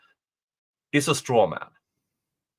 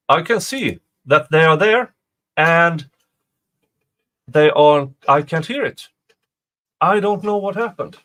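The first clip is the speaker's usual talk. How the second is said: louder, exasperated about the sound; frustrated; 4 semitones higher, 8 dB louder than usual. Real, not acted. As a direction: raise your voice in frustration. Stream sound suddenly broke.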